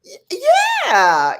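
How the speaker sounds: loud high burst of yes; eager exclamation; excited yeah. Real, not acted. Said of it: "Did they try to punk you?".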